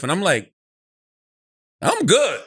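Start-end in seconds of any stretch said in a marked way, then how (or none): 1.8-2.4 a quick, chuckling "I'm good" that waves it away; laughing it off, like nothing is wrong; laughing brush-off. Retold or imitated. Brushing off the stripper warning.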